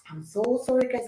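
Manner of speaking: ordinary talk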